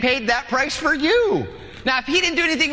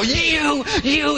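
sputtering driver; 5 semitones higher than usual. Acted. Driver furious in traffic.

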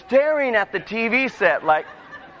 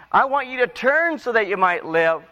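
raised appeal; 6 semitones higher than usual. Shown as a demonstration. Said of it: "plain repeat then lower".

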